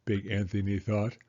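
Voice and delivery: ordinary delivery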